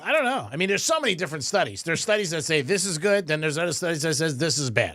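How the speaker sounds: ordinary talk, nothing marked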